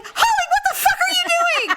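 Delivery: high-pitched retold shout; imitated outraged holler; yelling dad. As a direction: yell across a parking lot in disbelief. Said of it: "My dad starts yelling".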